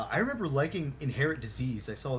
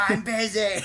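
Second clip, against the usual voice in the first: higher and louder, petulant brush-off; whiny dismissive; 9 semitones higher, 7 dB louder than usual. Retold or imitated. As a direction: brush them off, bratty. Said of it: "And he goes".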